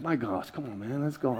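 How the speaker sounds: low, soft mutter; talking to himself. Retold or imitated. Impatient pastor in line grumbling to himself, muttered low and soft. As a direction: grumble under your breath, softly. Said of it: "I'm just talking to myself".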